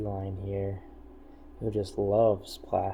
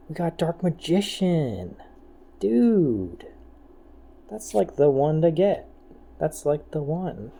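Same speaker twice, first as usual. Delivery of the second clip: high and loud with excitement; excited; 6 semitones higher, 6 dB louder than usual. Real, not acted. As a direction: gush over the card. Still excited about pull.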